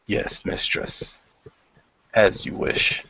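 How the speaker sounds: hushed, obedient reply; whispered obedience. Acted. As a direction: whisper assent. Knight replies.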